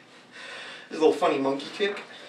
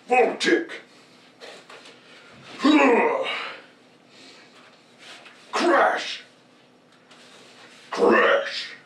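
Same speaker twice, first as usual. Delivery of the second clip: much higher, louder mock Tager shout; Tager impression; 8 semitones higher, 4 dB louder, much slower than usual. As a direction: bellow the move name like a wrestler, gravelly. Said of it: "more gravelly".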